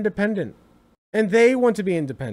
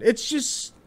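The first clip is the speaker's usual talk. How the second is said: ordinary talk, nothing marked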